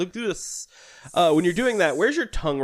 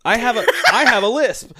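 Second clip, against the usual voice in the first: laughing; 9 semitones higher, 6 dB louder than usual. Real, not acted. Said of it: "It's the way he said it".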